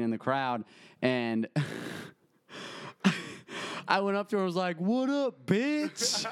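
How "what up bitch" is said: high, brash mock greeting. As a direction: toss it out cheekily like a tease. Greeting girlfriend mockingly in crowd.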